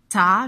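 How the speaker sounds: "ta" sounded loud and separate; syllable demonstration. Shown as a demonstration. Teacher helping children hear a syllable, "ta" loud and separate. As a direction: say "ta" firmly as one isolated syllable. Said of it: "j'entends t".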